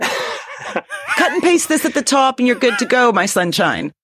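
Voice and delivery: slow, high, laughing smarm; smarmy lawyer voice. Retold or imitated. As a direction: purr it like a smug lawyer. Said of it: "the lawyers go".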